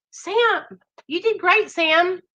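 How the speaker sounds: high, cooing praise; affectionate praise. Real, not acted. Host telling Sam he did great, high affectionate voice. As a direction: praise a friend in a high, sweet voice. Praising friend who just shared.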